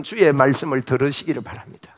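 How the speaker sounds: ordinary delivery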